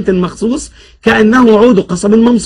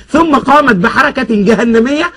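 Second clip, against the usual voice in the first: ordinary delivery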